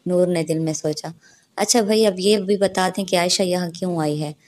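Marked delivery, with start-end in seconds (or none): none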